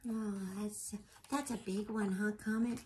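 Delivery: ordinary delivery, nothing marked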